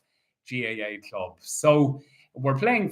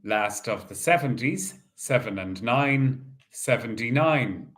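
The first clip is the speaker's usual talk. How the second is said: bingo caller. Acted. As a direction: call each ball out steadily. Calls bingo numbers.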